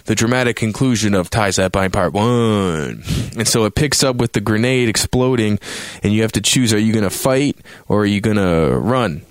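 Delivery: ordinary talk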